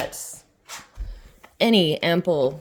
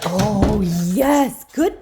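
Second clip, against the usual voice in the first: talking to a dog; 2 semitones higher, 4 dB louder, much slower than usual. Real, not acted. Praising the dog.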